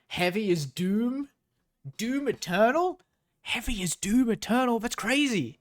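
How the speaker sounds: real sarcastic disbelief at the writing; mocking. Real, not acted. Mocking the book's phrase.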